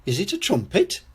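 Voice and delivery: put-on high, curious child voice; Peppa Pig. A narrator's reading. Peppa guesses at what she found, high and curious. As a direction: ask it high and wondering. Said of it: "says Peppa".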